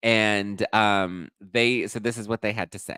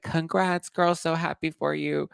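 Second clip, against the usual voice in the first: mock sweet congrats; 9 semitones higher than usual. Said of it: "she's just like".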